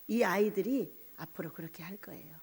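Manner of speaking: whispered prediction. Retold or imitated. Mother confiding to visiting pastor, whispering. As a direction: breathe it like a secret.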